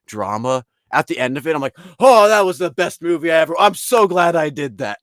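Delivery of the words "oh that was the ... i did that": loud, high, mock-gushing moviegoer. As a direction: burst out with over-the-top delight. Acts out gushing after a movie.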